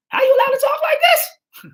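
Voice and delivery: a hammed-up outraged cry; mock outraged shout. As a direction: cry out as if scandalised. Mock outrage at quoted words.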